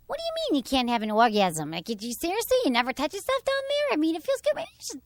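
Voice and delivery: high-pitched Midwestern New York mom; Midwestern New York accent. Retold or imitated. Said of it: "It's kind of Midwestern".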